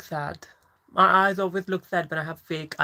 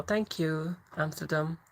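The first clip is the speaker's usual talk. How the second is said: usual speaking voice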